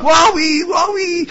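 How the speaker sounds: high-pitched mock wail of alarm; panicked screaming. Mob flees the dead man who rose, acted panicked cries. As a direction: cry out in hammed-up terror. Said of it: "אלה התחילו לצעוק".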